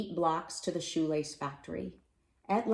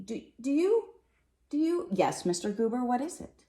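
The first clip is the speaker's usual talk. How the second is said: higher, halting and uneasy; nervous stammer; 7 semitones higher than usual. Acted. Mister Hatch asking the postman what's wrong, stammering nervously. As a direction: stumble over the words, suddenly anxious.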